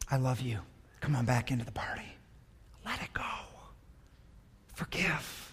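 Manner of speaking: soft, hushed loving father; tender father. Retold or imitated. The father to his angry son, softly tender. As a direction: soften to a near whisper, pleading gently. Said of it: "Father's like".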